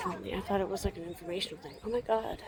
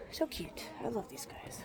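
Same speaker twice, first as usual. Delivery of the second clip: soft whisper of delight; tender whisper; 3 semitones higher, 13 dB quieter than usual. Real, not acted. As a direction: whisper lovingly to the little animals. Whispering fondly at small animals.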